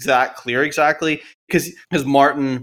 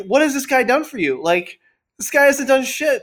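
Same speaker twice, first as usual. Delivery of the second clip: much higher, exasperated disbelief; mock incredulous; 12 semitones higher than usual. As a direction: scoff at the hopeless husband in a high pitch. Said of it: "And it's like".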